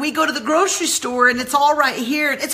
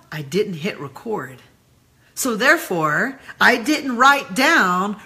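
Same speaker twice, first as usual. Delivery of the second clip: usual speaking voice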